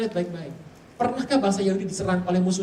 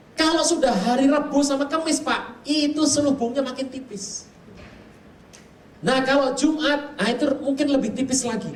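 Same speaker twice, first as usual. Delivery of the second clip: higher, louder and slowed explanation; shaman's explanation; 8 semitones higher, 4 dB louder than usual. Retold or imitated. Preacher voices dukun's explanation.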